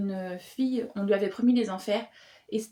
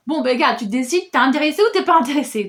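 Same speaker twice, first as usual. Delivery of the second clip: louder, higher, impatient "make up your mind"; exasperated push; 5 semitones higher, 9 dB louder than usual. Retold or imitated. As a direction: shove the hesitant guy to decide, fed up. Exasperated reader addressing hesitant character.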